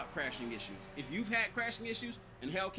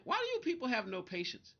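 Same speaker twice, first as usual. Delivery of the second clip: usual speaking voice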